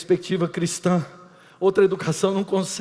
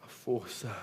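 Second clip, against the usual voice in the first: drops much softer into a whisper; fading to whisper; 8 semitones lower, 15 dB quieter than usual. Real, not acted. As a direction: let it die away to a breath. Final dramático sussurrado. (voz sumindo até o sussurro)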